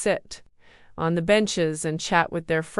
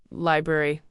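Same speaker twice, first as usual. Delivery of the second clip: plain reading voice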